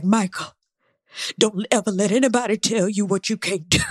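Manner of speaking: lightly voiced stern mother; a hint of a firm, loving mom; Angela Bassett. Recapper quotes a movie mother to her son, light stern mom voice. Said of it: "when Angela Bassett was like".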